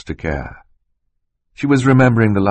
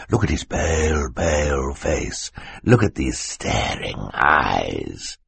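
taunting villain Stefano; 3 semitones lower, 5 dB quieter than usual. Acted. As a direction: draw it out cruelly, sinking very low at the end.

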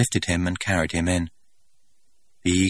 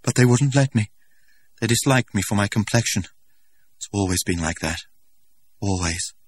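put-on hurt young man; 3 semitones higher, 3 dB louder than usual. A narrator's reading. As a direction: let the hurt sink into resignation. Young man's bitter complaint.

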